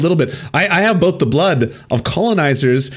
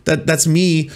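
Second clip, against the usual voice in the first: usual speaking voice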